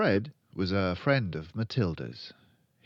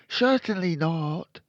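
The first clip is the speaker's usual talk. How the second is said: put-on loud, high boy's refusal; high-pitched, loud boy character; refusing Fred; 10 semitones higher, 7 dB louder than usual. A narrator's reading. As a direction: refuse as an indignant small boy.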